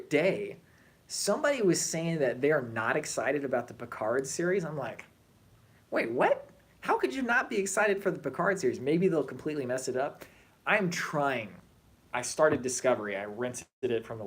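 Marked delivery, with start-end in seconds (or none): 5.9-8.8 high-pitched, disbelieving question; retold astonishment, pitch jumping up; incredulous reaction. Fan retelling reply to someone not excited about Picard, incredulous.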